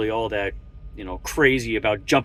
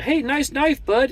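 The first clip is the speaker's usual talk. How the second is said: high, sing-song mock of a stranger; sneering high take on a nosy stranger; mocking nosy guy; 13 semitones higher than usual. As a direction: parody the fake-friendly opener, pitched way up. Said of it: "when it's a".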